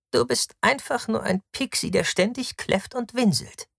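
high-pitched sneer; scornful woman's jeer, pitched well up. A narrator's reading. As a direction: taunt him in a high, scornful voice. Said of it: "höhnt Victra O'Julii".

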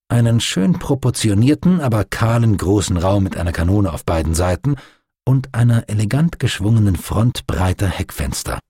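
ordinary delivery, nothing marked

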